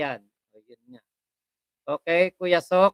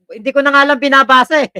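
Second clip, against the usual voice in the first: higher, louder, fast amused protest; excited laughing protest; 7 semitones higher, 9 dB louder, faster than usual. Real, not acted. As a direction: blurt it out amused. Laughing, refuses to read comment. (natatawang pagtanggi)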